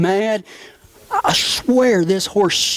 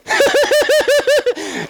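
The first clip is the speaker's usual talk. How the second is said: high giggle. Retold or imitated. Christy laughing while trick riding.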